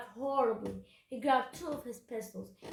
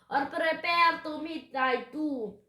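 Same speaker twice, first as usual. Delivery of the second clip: slow, raised, loud threat; drawn-out doom-laden pirate threat; pirate growl; 5 semitones higher, 7 dB louder, slower than usual. A narrator's reading. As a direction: menace them grandly. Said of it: "he growled".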